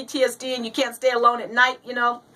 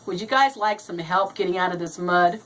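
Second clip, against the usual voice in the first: put-on calm old man; 8 semitones lower, 3 dB quieter than usual. Old man's calm punchline question.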